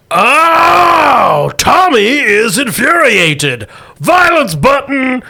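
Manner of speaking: loud, high, slow furious mutant bellow; enraged mutant. Acted. Shot mutant roars its fury at Hank, loud, high and slow. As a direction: roar it out slowly as a hulking brute. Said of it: "and goes".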